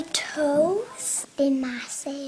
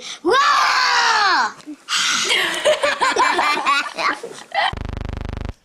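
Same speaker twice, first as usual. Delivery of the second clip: long loud roaring growl; dragon roar; 7 semitones higher, 4 dB louder than usual. Pretend. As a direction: let out a big dragon roar.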